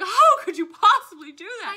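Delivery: loud high appalled question; scandalized ladies. Retold or imitated. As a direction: clutch pearls in outrage. Scandalized women voiced.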